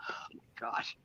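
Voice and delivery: breathless whispered aside; hushed oh my gosh. Real, not acted. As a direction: whisper it under your breath. Laughing aside after joke.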